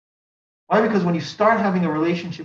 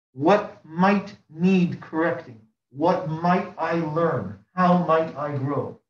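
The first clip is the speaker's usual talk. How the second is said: slow reflective questions; much slower than usual. Acted. Preacher voicing reflective questions.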